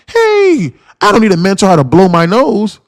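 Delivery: high scoffing mock voice on hey; mocking know-it-all. Streamer mocking a cocky newbie, high scoffing voice. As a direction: mimic a cocky newbie brushing off help.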